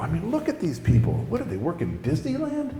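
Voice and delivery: high, hushed disbelief; incredulous inner grumble. Retold or imitated. Incredulous muttering to himself in church.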